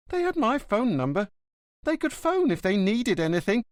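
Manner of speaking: indignant gossiping porter; bristling concierge. Acted. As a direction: snipe at the neighbours resentfully.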